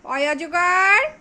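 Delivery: slow, loud teaching chant; slow loud recital. Shown as a demonstration. Mother recites for child, slow and loud. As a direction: spell it out slowly and loudly for the child. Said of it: "তুমি বলো".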